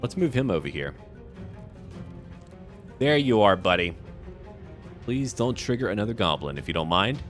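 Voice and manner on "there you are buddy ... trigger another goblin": ordinary talk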